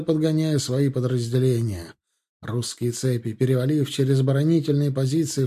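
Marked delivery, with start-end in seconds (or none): none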